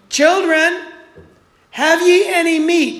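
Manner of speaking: Jesus calling loudly across the water. Acted. Jesus calling from the shore to the fishermen, loud call. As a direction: call out to the boat, high and loud.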